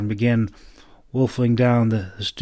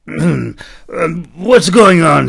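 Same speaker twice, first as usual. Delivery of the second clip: loud sudden demand; 6 semitones higher, 10 dB louder, slower than usual. A narrator's reading. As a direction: snap awake and demand answers. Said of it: "he demanded".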